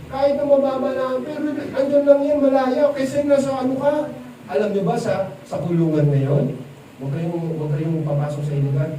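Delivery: ordinary delivery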